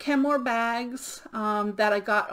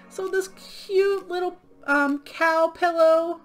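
higher, slow, doting coo; cooing over cuteness; 6 semitones higher, 3 dB louder, slower than usual. Real, not acted. Showing viewers the sold cow pillow, higher slow doting coo. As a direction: coo over it like a cuddly pet. Gushing over a cute plush.

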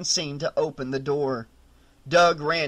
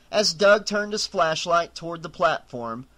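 ordinary delivery

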